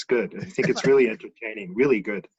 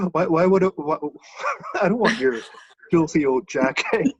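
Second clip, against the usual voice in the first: laughter breaking up the quoted words; laughing retelling; 5 semitones higher, 4 dB louder than usual. Retold or imitated. Friend refusing to buy an old jacket, retold through laughter. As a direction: crack up while imitating the refusal.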